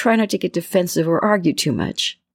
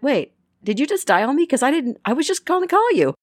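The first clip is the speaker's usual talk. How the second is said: rising higher and higher in disbelief; astonished; 7 semitones higher than usual. Retold or imitated. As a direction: climb up as the surprise sinks in. Retold phone coincidence, astonished.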